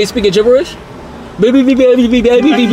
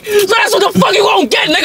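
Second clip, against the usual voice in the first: angry; 7 semitones higher than usual. Acted. Yelling at date.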